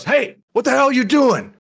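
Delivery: put-on high, loud barman snapping at a drunk; angry yell. Barman yells at spilled drinks.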